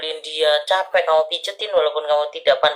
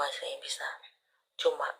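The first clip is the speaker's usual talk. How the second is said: whisper; 12 dB quieter than usual. Acted. Psychic reading, hushed aside.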